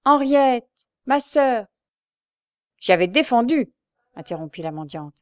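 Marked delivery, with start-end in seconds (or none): 0.0-1.7 high, loud imitation of her calling out; quoted desperate call, voice raised; retold cry. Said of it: "elle s'est mise à crier". 2.8-3.7 raised, loud self-justification; cross, forceful objection; indignant interruption. A narrator's reading. Beggar cuts in angrily.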